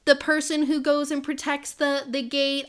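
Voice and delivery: playfully exasperated, insistent protest; mock protest. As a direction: plead like a reluctant hero who wants no part. Acting out reluctant hero's protest.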